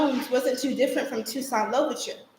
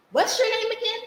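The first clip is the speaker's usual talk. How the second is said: much higher, amazed asking; incredulous question; 11 semitones higher than usual. Acted. Girl astonished at the boy's name, asking high. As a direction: ask like you can't believe your ears.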